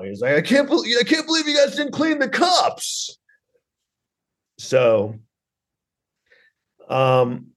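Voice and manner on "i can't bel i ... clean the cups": very high, hammed-up tirade; mock screaming boss. Boss berating kitchen workers over dishes, mock screaming. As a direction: imitate a furious boss at squealing pitch. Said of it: "screaming at them all the time".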